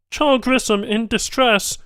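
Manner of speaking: high, far-off alien report voice; alien tech alert. A narrator's reading. Banu tech reporting the patient's distress, alien voice. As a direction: call it like a monitor alarm. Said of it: "one said, coming nearer".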